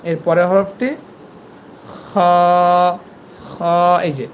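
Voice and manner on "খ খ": খ as a long rasped "khaw"; back-of-throat friction into a held খ; end-of-throat letter. Shown as a demonstration. Teacher showing where the letter comes from, long throaty খ. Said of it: "এই হরফটি গলার এই যে শেষ থেকে".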